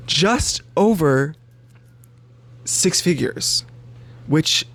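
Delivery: slow, spaced-out correction; careful self-correction. Real, not acted. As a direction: correct yourself deliberately, word by word. Slowly corrects own slip.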